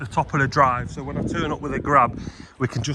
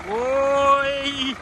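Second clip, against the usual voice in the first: calling out; 12 semitones higher than usual. Real, not acted.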